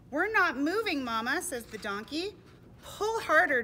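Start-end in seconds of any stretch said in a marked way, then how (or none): 0.1-1.4 high, loud complaint to Mama; distressed little storybook animal; alarmed young donkey. Acted. Young donkey stuck in mud. 2.8-3.6 high, loud call to pull; storybook animal straining to rally; urgent urging. Acted. Mother donkey urges in mud.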